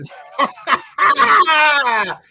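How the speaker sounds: loud high burst of laughter; a real, loud laugh at the joke; big laugh. Real, not acted. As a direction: crack up out loud. Laughing at a chat zinger.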